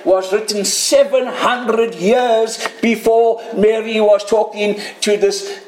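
normal talking voice

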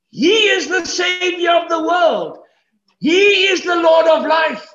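high, loud preacher's climax; triumphant proclamation. Acted. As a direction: proclaim it at full force to the whole congregation. Preacher proclaims the angel's message.

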